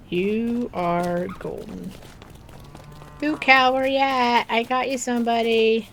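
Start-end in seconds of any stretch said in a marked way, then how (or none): 3.2-5.9 calling out. Real, not acted. Calling out to a villager.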